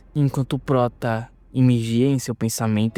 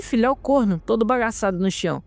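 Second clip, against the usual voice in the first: ordinary delivery, nothing marked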